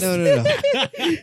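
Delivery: high squealing laugh through no; giggling no, no, no; laughing refusal. Real, not acted. Refusing to show nails, laughing.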